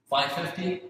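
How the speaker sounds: normal talking voice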